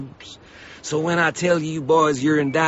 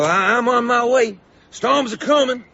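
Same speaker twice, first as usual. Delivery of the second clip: urgent warning; 8 semitones higher, 5 dB louder than usual. Acted. Salesman warns of coming storms.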